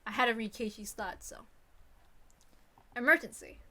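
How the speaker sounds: ordinary talk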